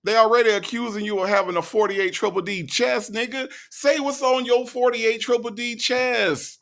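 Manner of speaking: very high-pitched, comic outburst; exasperated yelling. Real, not acted. Host heckling Dre to say what's on his mind, exasperated. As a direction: holler up high, goading him to speak up.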